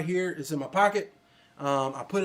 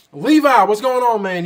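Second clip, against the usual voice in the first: much louder, higher call of his name; loud greeting; 4 semitones higher, 12 dB louder than usual. Real, not acted. Streamer spots a regular in chat, calls out loudly.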